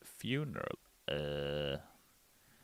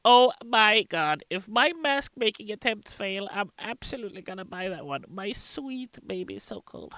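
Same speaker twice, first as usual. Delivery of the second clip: much higher, gushing fan read; 14 semitones higher, 5 dB louder than usual. Retold or imitated. Reading a fan's chat message, high and gushing. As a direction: gush it like a starstruck fan. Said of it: "Gidget the Goblin says".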